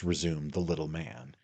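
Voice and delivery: ordinary delivery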